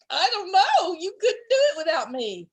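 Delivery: high, loud, laughing brag; boastful. A narrator's reading. Groundhog swelling his chest, boasting. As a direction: puff up and brag.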